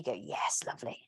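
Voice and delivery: soft, low, breathy whisper. Real, not acted. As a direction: whisper the praise. Soft aside during a pose.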